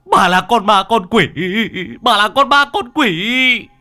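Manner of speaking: high, loud panicked cry, slowing down; terrified scream. A narrator's reading. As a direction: scream it at the ghost in terror. Man screams at ghost. (hét lên kinh hoàng)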